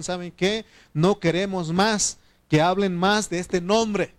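loud, slow warning from the council; stern threat. Retold or imitated. Council threatening the disciples: loud, slow, stern. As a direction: lay down the order slowly and loudly.

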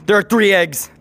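normal talking voice

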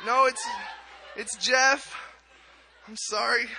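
high, pained mock protest; exasperated apology. Acted child despairing at the mispronunciation.

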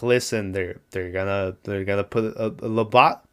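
normal talking voice

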